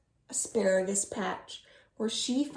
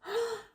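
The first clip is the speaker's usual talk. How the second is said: gasp; 12 semitones higher, 4 dB quieter than usual. Pretend. Cookie gone.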